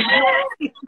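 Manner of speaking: sudden high squeal on the last words; jokey squeal of giving up; despairing squeal. Real, not acted. Laughing confession of failing to preach.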